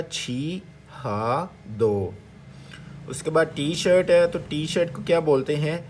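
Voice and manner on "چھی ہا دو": syllable-by-syllable demo. Shown as a demonstration. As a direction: stretch out چھی, ہا, دو for learners. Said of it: "تو کیا بنے گا". (الگ الگ جز کھینچ کر بولیں)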